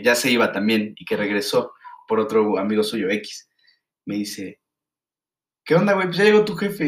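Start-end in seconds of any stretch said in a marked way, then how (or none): 5.6-6.9 high, loud hurried warning from a friend; urgent warning. Retold or imitated. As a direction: blurt the news that his dad showed up. Friend breaks news about dad.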